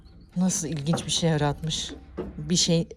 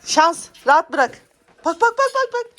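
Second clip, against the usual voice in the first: high, loud call to a pet; calling the dog; 9 semitones higher, 6 dB louder than usual. Real, not acted.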